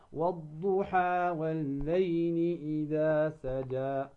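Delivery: slow recitation with two-beat madd; correct recitation. Shown as a demonstration. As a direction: recite والضحى holding only two counts. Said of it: "فقط بمقدار ماذا؟ حركتين".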